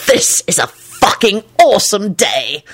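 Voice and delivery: hearty, emphatic enthusiasm; enthusiastic agreement. A narrator's reading. Agreeing with his gleeful mate, enthusiastic. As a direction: agree with relish. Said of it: "Lupin agreed enthusiastically".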